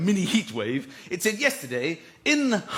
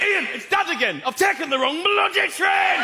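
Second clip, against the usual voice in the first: high, loud phone shout; loud dad call; 9 semitones higher than usual. Retold or imitated. Dad phoning his son from the train, loud. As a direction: bark it down a bad line. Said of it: "He picked up the phone and went".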